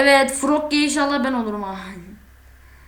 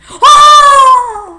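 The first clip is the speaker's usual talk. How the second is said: scream; 13 semitones higher, 16 dB louder than usual. Real, not acted. Caught by monster, screaming.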